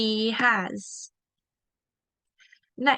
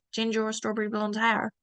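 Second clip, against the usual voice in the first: normal talking voice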